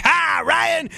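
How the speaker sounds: high-pitched shouted squeal of a greeting; mock screaming. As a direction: imitate a star screaming over a crowd. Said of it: "like, screaming and shouting".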